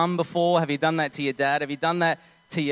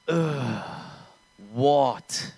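imitating a huffy teen's groan; arrogant teenager; 2 semitones lower than usual. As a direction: groan it out like a put-upon adolescent. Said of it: "Like an arrogant, pimply, thirteen to fourteen-year-old".

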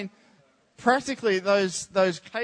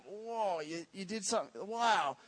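much softer, awed whisper-like tone; awed hush; 11 dB quieter than usual. Retold or imitated. Churchgoers amazed at someone's faith, hushed awe. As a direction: breathe it out in quiet awe. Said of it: "we look at them and go".

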